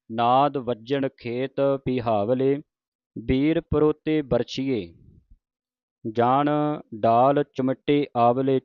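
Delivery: slow, measured verse reading; scripture recitation. Shown as a demonstration. Teacher recites verse to students, slow measured recitation. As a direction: stretch the line endings, reciting deliberately.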